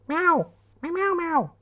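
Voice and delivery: high squeaky meowing; kitten mew. Pretend. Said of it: "do you have a kitten voice".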